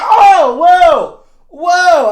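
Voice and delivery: high, loud shocked yelps; startled shriek; horrified yelp. Retold or imitated. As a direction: yelp like you just watched it die. Bear snaps neck in the game.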